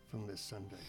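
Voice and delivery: ordinary delivery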